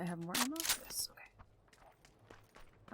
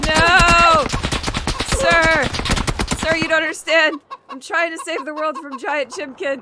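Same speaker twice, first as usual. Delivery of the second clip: loud high frantic pleading; panicking gamer; 7 semitones higher, 9 dB louder, slower than usual. Real, not acted. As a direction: beg dramatically after dying. Pleading after being killed.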